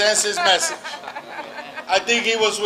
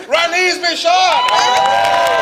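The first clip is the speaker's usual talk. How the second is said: calling out; 7 semitones higher, 4 dB louder, much slower than usual. Real, not acted. Introducing next speaker to crowd.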